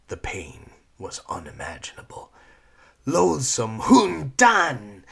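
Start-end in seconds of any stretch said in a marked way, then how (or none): 3.0-5.0 much louder, higher, slow shriek. Acted. Sifu shrieking at Lyra, furious shriek. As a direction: scream the insult. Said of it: "Sifu shrieked".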